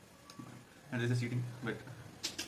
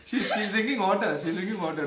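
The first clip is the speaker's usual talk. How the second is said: laughing; 7 semitones higher, 5 dB louder than usual. Real, not acted. Rival loses, drinks water.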